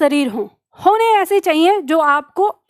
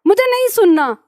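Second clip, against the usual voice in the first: sister complaining; 6 semitones higher than usual. Retold or imitated. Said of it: "तो मेरे से कहती है कान बंद करके".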